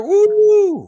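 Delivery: very high, loud party woo; excited whoop. Real, not acted. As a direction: let out a big party whoop. Cheering at the drink combo.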